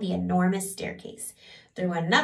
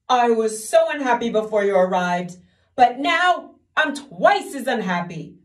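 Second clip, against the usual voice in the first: weepy king; 3 semitones higher, 8 dB louder than usual. Acted. Weeping king complains.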